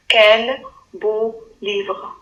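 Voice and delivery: calm slow repeat; slow plain read. Shown as a demonstration. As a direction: read it slowly for dictation. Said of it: "Phrase numéro trois".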